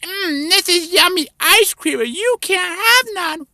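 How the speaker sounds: high, mocking kid voice; high bratty child gloating over ice cream; taunting little kid. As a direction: tease him like a bratty child. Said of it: "Like there, see?".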